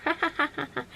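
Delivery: high, teasing mock laugh; imitated child's giggle. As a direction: mimic a little girl caught sneaking. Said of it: "she'd be like".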